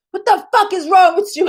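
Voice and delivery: high angry demand at Half Pint; retold rant. Attacking the actress on Twitter, high outraged yell. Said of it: "and I was like".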